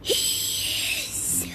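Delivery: whisper. Acted. Professor pointing at the real one.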